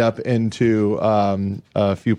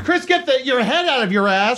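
pundit voice; 12 semitones higher than usual. Pretend. Mock pundit shouting match.

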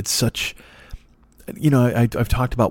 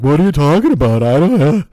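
much higher, louder baffled voice; mock clueless clerk; 5 semitones higher, 9 dB louder than usual. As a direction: play the confused employee who knows nothing. Imagined clueless staff at pickup.

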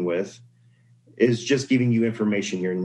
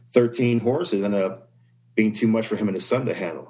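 ordinary talk

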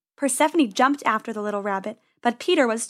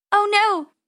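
high, loud cry of alarm; yelling; 10 semitones higher, 5 dB louder than usual. Acted. Penny seeing the gate blocked, yelling. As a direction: yell it in dismay. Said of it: "yelled Penny".

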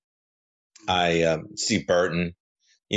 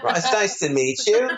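very high, hammed-up British voice; British accent. Guest imagines meeting the cast as a Brit, mock British accent. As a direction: put on a silly high-pitched British accent. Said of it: "And I'll be British".

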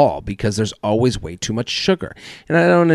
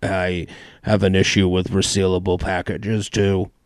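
lower, masked mystery-guest voice; disguised voice; 4 semitones lower than usual. Pretend. Anonymous celebrity complaining, lightly disguised low voice. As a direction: hide who you are with a modulated voice. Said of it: "voice modulations".

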